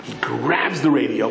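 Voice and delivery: usual speaking voice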